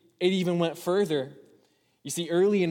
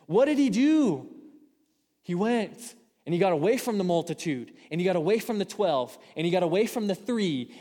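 higher, louder preacher climax; fiery preaching; 5 semitones higher, 5 dB louder than usual. Acted. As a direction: build the sermon to full force.

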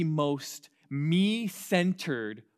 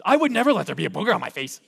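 louder, quick indignant protest; indignant denial; 5 semitones higher, 9 dB louder, faster than usual. Retold or imitated. Proud church member denying the booger, in a loud indignant protest. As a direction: huff it out like you're insulted.

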